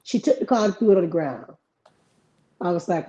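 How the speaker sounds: ordinary talk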